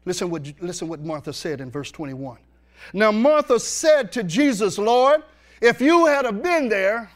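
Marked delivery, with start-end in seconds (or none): 2.9-7.1 loud, high, slow scripture reading; preacher reading scripture at full voice; preaching scripture. Acted. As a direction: proclaim the verses to the congregation. Preacher reads scripture aloud.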